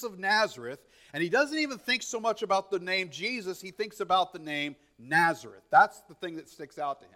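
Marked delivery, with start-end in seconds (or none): none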